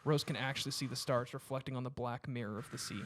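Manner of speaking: ordinary delivery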